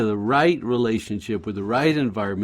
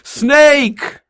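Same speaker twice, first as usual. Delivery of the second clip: high, loud alarmed shriek; yelling snake; 9 dB louder than usual. Retold or imitated. Wife yelling from the backyard, high alarmed shriek. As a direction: shriek it like you just spotted a snake. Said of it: "And she yells".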